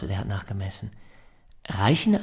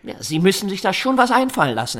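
höher und lauter fordern; fordernd laut; 9 semitones higher, 6 dB louder than usual. Acted. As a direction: den Druck auf den Architekten erhöhen. Drohung gegenüber dem Architekten. (laut und hoch, drängend)

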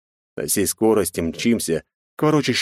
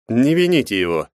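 calm commanding voice; 4 semitones higher, 3 dB louder than usual. Acted. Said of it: "спокойный властный голос".

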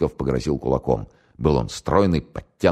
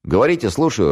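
called-out answer; 7 semitones higher, 3 dB louder than usual. A narrator's reading. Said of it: "раздалось в ответ".

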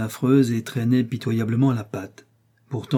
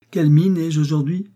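louder, higher question aloud; tout haut; 6 semitones higher, 5 dB louder than usual. A narrator's reading. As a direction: ask it out loud, pitched up. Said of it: "demandai-je tout haut". (question lancée tout haut)

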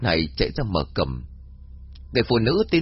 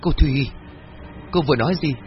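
higher-pitched, shocked stammer; trembling, stammering question; 6 semitones higher than usual. A narrator's reading. Village head questions the bereaved daughter, trembling with shock. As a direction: falter and repeat in disbelief. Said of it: "ông Kha liền run giọng hỏi".

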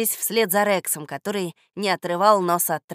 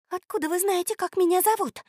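put-on high-pitched girlish wonder; surprised; 11 semitones higher than usual. A narrator's reading. Girl hearing a stranger use her name, surprised. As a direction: ask in high, puzzled surprise. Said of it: "удивилась Люся".

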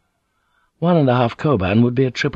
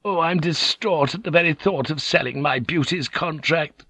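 higher, softer theatrical lament; mock groaning madam; 7 semitones higher, 4 dB quieter than usual. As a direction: ham up her distress over the contract. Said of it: "Gyoko was groaning".

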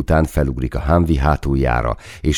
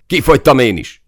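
high, loud battlefield yell; urgent shout; 12 semitones higher, 7 dB louder than usual. A narrator's reading. Soldier out of ammo to his comrade, shouted. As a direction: yell it out in alarm.

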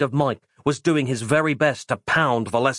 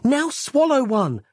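high, slow, insistent command; desperate urging; 12 semitones higher than usual. A narrator's reading. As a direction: urge him desperately, pitched up. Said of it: "sensed the desperation in her own voice".

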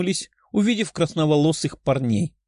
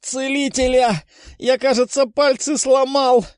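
pained cry; 10 semitones higher than usual. A narrator's reading. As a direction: call for help in agony. Injured boy calls for a healer.

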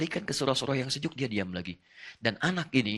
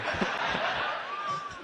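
laugh; 5 dB quieter than usual. Real, not acted. Laugh after describing silence.

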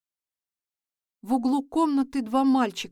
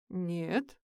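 cool; 5 semitones lower, 7 dB quieter than usual. Acted. Nick coolly questions refusal.

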